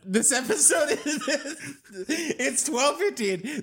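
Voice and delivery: cracking up mid-sentence; can't hold back laughter; laughing. Real, not acted. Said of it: "Dude, stop smiling".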